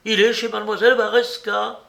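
high, loud urgent shouting; crying out. A narrator's reading. Jacques sure he is at mademoiselle's, crying out. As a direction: shout the certainty to everyone. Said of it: "s'écria Jacques".

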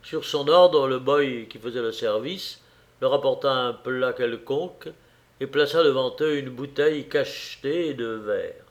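plain reading voice

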